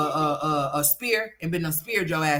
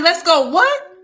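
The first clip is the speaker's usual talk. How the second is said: mock surprise; 13 semitones higher, 9 dB louder, slower than usual. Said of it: "I'll be like".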